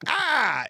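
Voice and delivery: sudden high yelp of pain; a retold, high yelp of pain. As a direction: cry out as the nail hits. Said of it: "you're just like".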